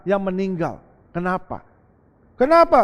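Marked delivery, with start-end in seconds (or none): 1.1-1.6 asked softly, almost to himself; a low, soft why; quiet question. Acted. Preacher asks the congregation softly first, quiet. 2.4-2.8 louder and higher, pressing the question; loud demanding why. Acted. Preacher repeats the question to the congregation, loud.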